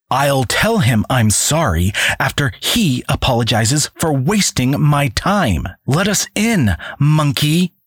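high, imperious demand; curt, superior command; haughty Cassandra. Acted. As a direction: order the brute aside. Cassandra snaps at guard.

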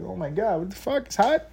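hushed high-pitched imitation of amazement; imitated shock. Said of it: "She's shocked by it".

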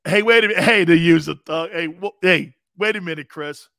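put-on loud call of an old man stopping him; grandfather calling out. Grandfather stopping his upset grandson, loud call. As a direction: call him back loudly.

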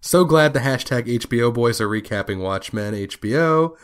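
ordinary talk

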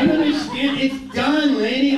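ordinary talk

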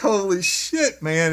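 loud, high, drawn-out exclamation; horrified shock. Retold or imitated. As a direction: stretch it out in retold disbelief. Retold horrified reaction at the scene.

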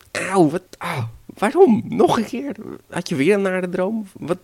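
high, loud wail of protest; exasperated devil. Acted. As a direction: complain at being woken by pain. Devil woken a third time. (geërgerde hoge uitroep)

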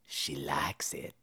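low, slow whisper; slow, breathy stage whisper; whispered aside. Acted. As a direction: whisper it like a secret.

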